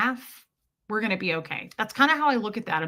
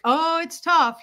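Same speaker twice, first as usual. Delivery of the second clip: rueful mustache guy; 8 semitones higher, 4 dB louder than usual. Retold or imitated.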